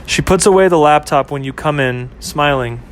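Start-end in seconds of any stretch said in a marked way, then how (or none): none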